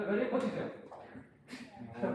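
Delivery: usual speaking voice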